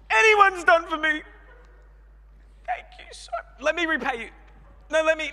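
hammed-up overwhelmed thanks, squealing; overwhelmed gratitude. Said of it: "let me shout you that five dollar coffee".

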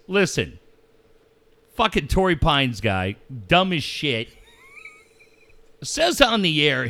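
ordinary delivery

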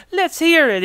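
high-pitched fake Italian accent; bad Italian accent. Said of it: "like, a bad, like, Italian accent".

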